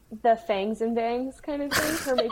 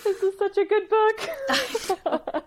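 high, drawn-out mock wail; high-pitched gushing, half laughing; overwhelmed outburst; 11 semitones higher, slower than usual. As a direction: wail it like a reader overcome by the book. Re-enacts her emotional reaction to book.

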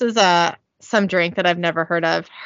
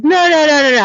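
loud protest; 6 semitones higher, 5 dB louder, much faster than usual. Acted. Drunk Dean protesting.

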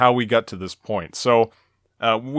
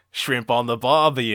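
mock Australian accent; 2 semitones lower than usual. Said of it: "Australian accent".

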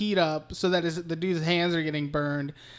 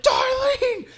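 much higher, louder call; mock desperate yell; 5 dB louder than usual. [name] chasing Darlene's reversing car, hammed-up yell. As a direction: holler after her in hammed-up panic.

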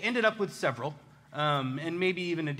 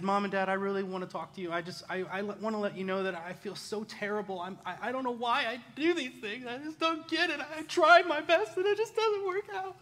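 pleading, climbing much higher into a whine; hammed-up tearful apology; 5 semitones higher than usual. Boy begging parents to let him go, hammed-up tearful plea. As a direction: beg forgiveness, voice rising as it cracks. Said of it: "I probably did better than, than I just did".

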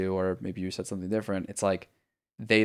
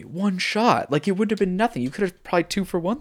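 excited; 9 semitones higher, 4 dB louder than usual. Real, not acted. Excited about lost power.

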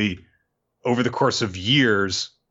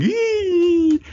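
very high, drawn-out "eee"; imitated squeal; 4 dB louder than usual. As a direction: let out a sustained high squeal. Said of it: "and is going".

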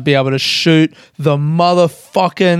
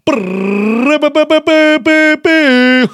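big burst of laughter; loud laugh; 11 semitones higher, 5 dB louder than usual. Real, not acted. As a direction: cracks up loudly. Laughs at his own drum roll.

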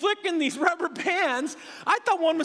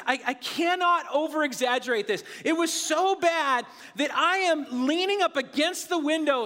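normal talking voice

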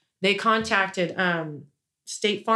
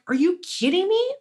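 much higher, indignant question; incredulous outrage; 10 semitones higher than usual. Retold or imitated. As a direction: demand it in shocked anger. Retelling outrage at fraud claim.

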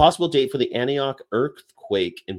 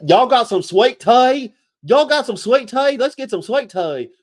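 high, loud hammed-up Southern twang; 14 semitones higher, 8 dB louder than usual. Mocks sweet tea drinkers' drawl.

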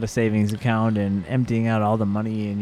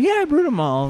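much higher, breezy confirmation; proud brewer. Retold or imitated. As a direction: shrug it off proudly. Brewer confirms in retold story.

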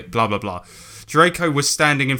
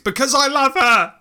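hammed-up lovesick shriek; 12 semitones higher than usual. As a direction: scream the declaration of love to the teachers. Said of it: "Draco shrieked".

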